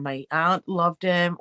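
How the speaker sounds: ordinary talk, nothing marked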